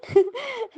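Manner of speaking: high bright laugh bursting out; a real laugh that opens the line; burst of laughter. Real, not acted. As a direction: laugh out loud before speaking. Laughing before speaking.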